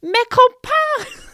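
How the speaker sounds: slow, high, loud French cry; panicked attempt at French. Pleading through the closing door in French, panicked cry. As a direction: stretch out a desperate French call. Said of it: "tried to say it in French".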